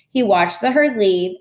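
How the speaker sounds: usual speaking voice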